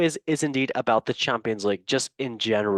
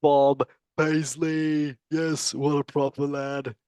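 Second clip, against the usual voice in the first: a hint of a made-up bloke voice, slow on the name; odd put-on voice; 2 semitones higher, slower than usual. Quizmaster praising the right answer in a lightly put-on silly voice. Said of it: "I don't know what voice this is".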